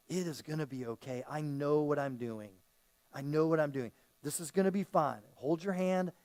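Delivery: low, softened bedside reassurance; calm a patient before surgery, voice dropped low; reassuring doctor. Acted. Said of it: "like a doctor before you go into surgery".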